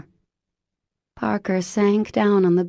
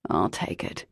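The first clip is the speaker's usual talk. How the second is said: low male voice; 11 semitones lower, 4 dB quieter than usual. A narrator's reading. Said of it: "he said".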